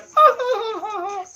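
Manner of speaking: high falsetto mock cackle; put-on high giggle imitating young people; hammed-up laugh at the old man; imitated mocking laugh. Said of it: "they're probably just laughing like".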